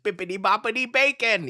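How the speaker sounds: high sing-song comic voice; playful high bippity boppity bacon; Jim Gaffigan bit. Retold or imitated. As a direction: chant it like a silly spell. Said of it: "stand-up comedian".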